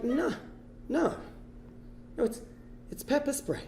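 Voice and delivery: very soft, halting correction; quiet denial. Retold or imitated. Traveller correcting a security guard, very soft and hesitant. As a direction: correct them gently, barely voiced, with long pauses.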